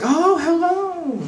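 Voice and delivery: very high, drawn-out singsong greeting; friendly neighbor. Retold or imitated. Neighbor getting into the elevator, high cooing hello. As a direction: greet slowly in a high put-on voice. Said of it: "one of my neighbors got in".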